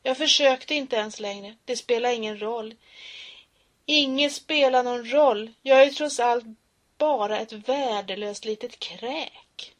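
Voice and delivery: loud anguished voice; victim's despairing cry; despairing outburst. Acted. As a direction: cry out in hopelessness.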